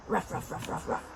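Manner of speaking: soft, low string of little ruffs; dog bark. Shown as a demonstration. Asking a child to say ruff, soft pretend barking. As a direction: woof quietly in quick bursts. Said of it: "Can you say ruff?".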